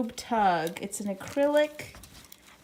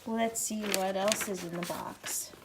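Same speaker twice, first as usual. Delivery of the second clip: whispered so the dog won't notice; 5 semitones lower, 6 dB quieter than usual. Real, not acted. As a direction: breathe it out, sneaking a peek. Sneaking a look while dog plays.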